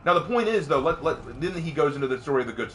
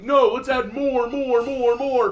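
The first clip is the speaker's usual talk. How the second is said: much higher and louder, chanting more, more, more; mock greedy writer; 13 semitones higher, 8 dB louder, slower than usual. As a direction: parody a greedy studio pushing for more. Mocking writers' greedy idea.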